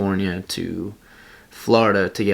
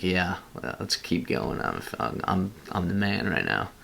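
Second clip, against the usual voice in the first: hushed self pep talk; 7 dB quieter than usual. Retold or imitated. Inner pep talk on mountain.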